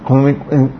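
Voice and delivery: ordinary delivery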